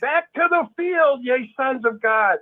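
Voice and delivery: high, slow, grand proclamation; hammed-up summons to the faithful; mock elder's announcement. Leaders sending brothers back to the field, mock proclamation. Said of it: "and they say".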